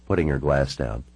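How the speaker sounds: ordinary delivery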